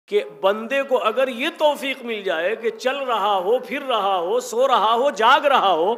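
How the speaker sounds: preaching at full force; building fervor. Real, not acted. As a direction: build the list to a loud peak.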